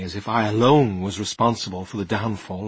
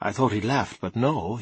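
ordinary delivery

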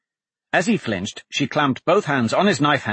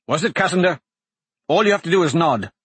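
angry; 6 semitones higher, 3 dB louder, faster than usual. Acted. Dante presses the dying assassin.

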